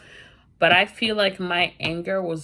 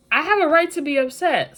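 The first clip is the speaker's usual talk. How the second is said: raised high and loud, defending herself; indignant protest; 9 semitones higher, 5 dB louder than usual. Retold or imitated. Talking back to a friend who called her spoiled, indignant and loud. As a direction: insist you have every right, voice up high.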